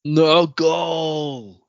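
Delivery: put-on loud Schwarzenegger accent shout. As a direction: yell like Arnold at a match. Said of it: "Schwarzenegger's watching a soccer game and he's yelling".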